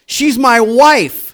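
high, loud, slow retort; indignant insistence. Retold or imitated. Husband tells the woman on the phone who Starla is, indignant. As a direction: stretch it out in mock indignation.

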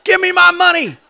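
high, shouted demand; angry demand. Acted. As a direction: bark it at his throat. Servant choking his debtor.

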